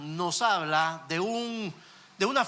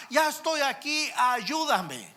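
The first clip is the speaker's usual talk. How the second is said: put-on higher, urgent child's plea; mildly acted frightened boy calling to dad; child calling for help; 6 semitones higher, slower than usual. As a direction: call up from the window, begging. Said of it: "le sigue gritando al papá".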